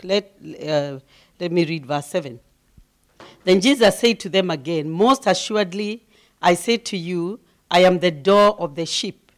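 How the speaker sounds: usual speaking voice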